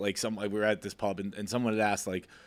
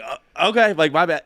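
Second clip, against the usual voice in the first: sheepish; 5 semitones higher, 6 dB louder than usual. Retold or imitated. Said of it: "and I was like".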